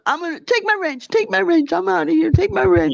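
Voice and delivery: high, mocking whine of someone storming off; a sarcastic parody of a flounce-out; mocking Jules storming off. Mocking Jules quitting in a huff.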